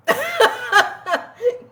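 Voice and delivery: a burst of real high laughter; breaking into a high giggle. Real, not acted. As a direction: laugh out at how absurd it was. Laughing at the note.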